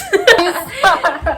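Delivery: high burst of giggles. Real, not acted. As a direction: break into a giggle at the sign-off. Giggling at the sign-off.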